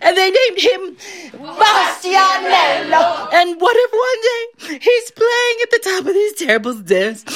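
high, tearful wife's wail; hammed-up sobbing distress; weeping and wailing. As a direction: wail the imagined tragedy to your husband. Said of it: "he hears all this weeping and wailing".

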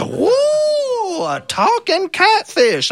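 high, amazed cry, slow on the end; astonished. Acted. Fisherman astonished when the catfish speaks, high amazed cry. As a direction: squeal it out in wide-eyed wonder.

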